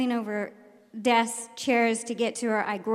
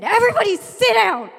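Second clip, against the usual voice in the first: high, loud classroom order; yelled command; 10 semitones higher, 9 dB louder than usual. Retold or imitated. Teacher losing control of crazy kids, shouted order. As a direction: shout the room into their seats.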